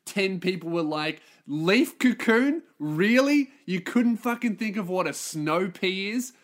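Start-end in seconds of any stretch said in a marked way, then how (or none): 1.5-6.3 mock them with drawn-out disbelief; incredulous mockery. Ten listeners roast the host for a silly word, incredulous mockery.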